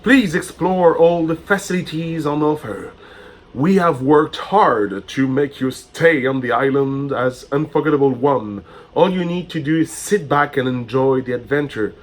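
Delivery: a touch of theme park announcer; formal, lifted welcome read; grand welcome speech. Acted. Reads welcome letter aloud.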